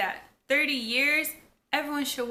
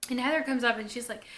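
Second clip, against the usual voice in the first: normal talking voice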